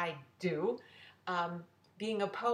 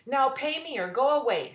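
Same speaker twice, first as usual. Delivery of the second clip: louder, higher impatient order; impatient demand; 6 semitones higher, 8 dB louder than usual. Acted. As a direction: tell her off, pushing her to pay. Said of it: "said Mister Bear".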